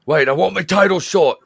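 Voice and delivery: loud, high demand for a title shot; demanding wrestler. Retold or imitated. Wrestler yelling at the boss for his title shot, loud. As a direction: yell it like an angry wrestler.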